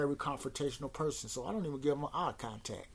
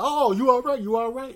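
loud, high mock concern; imitating a pushy, insincere "you okay?"; 10 semitones higher, 12 dB louder than usual. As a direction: exaggerate a fake check-in, raised and loud. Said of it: "It didn't seem sincere to me".

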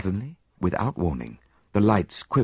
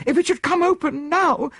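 very high, loud panicked woman; terrified gasp; 5 dB louder than usual. A narrator's reading. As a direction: fear pushing the voice right up. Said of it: "she gasped".

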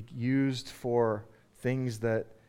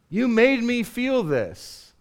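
slow, higher-pitched blame; mock accusation; 7 semitones higher, 3 dB louder, slower than usual. Preacher acting out someone shifting blame, mock accusation. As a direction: put the blame on them, slow and high.

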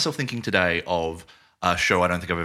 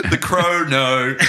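said higher and louder through a laugh; 5 semitones higher, 6 dB louder than usual. Real, not acted. Repeating the friend's word, cracking up laughing. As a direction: giggle the word out. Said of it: "it does sound wrong when you say it".

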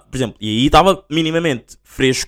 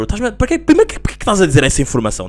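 exasperated rant; 7 semitones higher than usual. Real, not acted. Venting about friend's pointless info.

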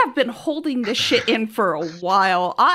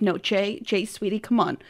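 mock sweet coaxing; 5 semitones lower, 6 dB quieter than usual. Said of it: "so I'm like".

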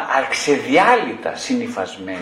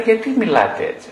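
higher, indignant client pushing back; mock indignant client; 8 semitones higher than usual. Client confronting the therapist in session, offended high voice. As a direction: raise the pitch like a baffled, offended client.

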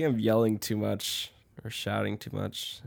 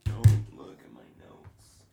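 unvoiced stage whisper; 8 dB louder than usual. Real, not acted. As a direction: whisper a playful warning. Leaving the room, warning the co-host.

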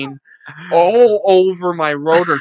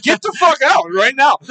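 higher, louder furious order; retold yell; 10 semitones higher, 6 dB louder than usual. Ordering the friend out of the house, furious yell. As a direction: throw him out of the house.

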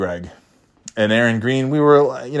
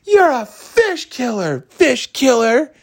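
higher, slow sing-song taunt; taunting kid; 14 semitones higher, slower than usual. Retold or imitated. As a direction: drag it out like a mean playground jeer. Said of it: "scowling at me and going".

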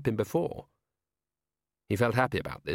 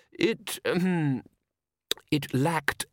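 put-on hesitant old lord; 5 semitones higher, much slower than usual. A narrator's reading. Downey hesitates, clears throat.

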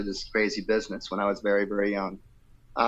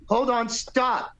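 high, slow, drawn-out command to halt; acted interruption; 12 semitones higher, much slower than usual. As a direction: imitate someone abruptly halting an audition. Acts out [name] halting the audition.

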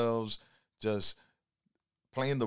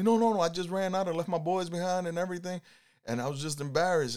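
excited passenger; 8 semitones higher, faster than usual. Retold or imitated. Passenger recounts running out embarrassed.

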